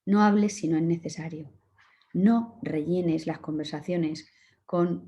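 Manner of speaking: ordinary talk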